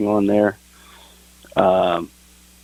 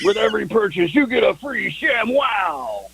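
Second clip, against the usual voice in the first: infomercial pitchman; 10 semitones higher than usual. Pretend. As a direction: sell it like a late-night TV ad. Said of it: "sound like an infomercial".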